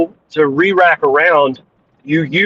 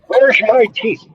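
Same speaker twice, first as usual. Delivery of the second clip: toothless; 6 semitones higher than usual. Acted. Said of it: "I love it".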